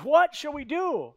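high, drawn-out panicked plea; panicking. Acted. Servant asking his master for help, panicking. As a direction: wail it in acted panic. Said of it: "He's panicking, freaking out".

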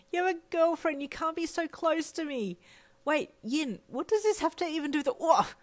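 high-pitched, panicky wail; flustered protest. A narrator's reading. Protesting closeness with a taken friend, high and panicky. Wailed protest continues, struggle and fall.

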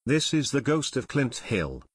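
ordinary delivery

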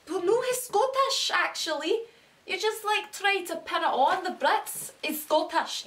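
put-on high, loud indignant Scottish accent. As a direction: protest in a hammed-up Scottish accent. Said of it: "I actually watched Braveheart yesterday".

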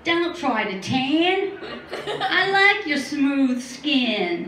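slow, drawling Australian accent imitation; lightly done Aussie boy accent. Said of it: "My Australian accent always turns into a southern drawl".

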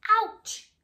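high loud yelp; ouch. Acted. A veggie bumping into another, yelping high. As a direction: yelp in mock pain.

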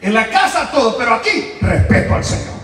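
high, loud preacher's cry; loud laughing shout to the congregation; preaching shout. Real, not acted. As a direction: proclaim it to the whole church.

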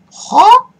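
a high, loud, disbelieving huh?; the controls just died on you, shout it; outraged huh. Real, not acted. Frustrated at the broken controls, a high disbelieving shout.

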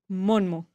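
Monmo, drawn out with an N in the middle. Shown as a demonstration. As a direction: say Monmo slowly, sounding the middle N. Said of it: "le voy a agregar una N en medio".

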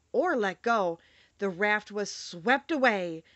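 ordinary delivery, nothing marked

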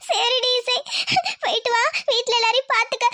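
high, tearful farewell; crying. Acted. Elder sister sending her little sister off home, crying. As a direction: sob through a goodbye. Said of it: "அழாதக்கா, நான் பாத்துக்கிறேன்க்கா".

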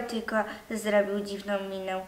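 ordinary delivery, nothing marked

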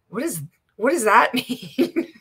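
can't hold back a laugh at it; chuckling while asking what it means; laughing disbelief. Real, not acted. Laughing at a comment.

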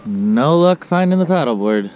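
ordinary delivery

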